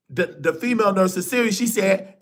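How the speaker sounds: normal talking voice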